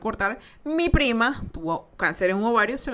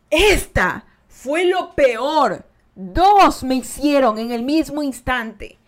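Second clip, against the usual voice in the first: lento; 5 dB louder, much slower than usual. Real, not acted. Recuerda el dolor.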